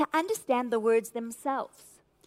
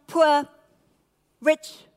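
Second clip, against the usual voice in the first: normal talking voice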